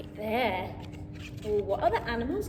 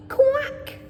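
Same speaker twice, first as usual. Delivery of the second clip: duck quack; 8 semitones higher, 5 dB louder than usual. Acted. Children repeat animal noises.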